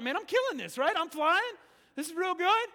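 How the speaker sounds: high, loud, cocky self-congratulation; mock overconfidence. Preacher imitating himself thinking he flies well, gleefully cocky. Acts out his overconfident flying thoughts.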